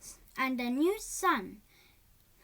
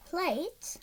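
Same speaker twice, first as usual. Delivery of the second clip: usual speaking voice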